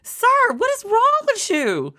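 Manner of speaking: high incredulous squeal at the president; incredulous shriek. Acted. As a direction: screech it in disbelief. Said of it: "I was like".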